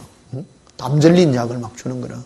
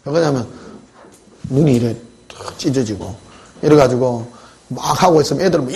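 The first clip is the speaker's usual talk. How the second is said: ordinary talk, nothing marked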